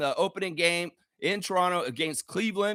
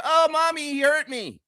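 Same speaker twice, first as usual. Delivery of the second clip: put-on high, hurt little-kid whine; mock a child crying to mommy; whiny child; 8 semitones higher than usual. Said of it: "You acted like a child in the corner".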